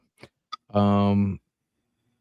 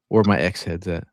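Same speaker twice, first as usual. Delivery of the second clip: ordinary talk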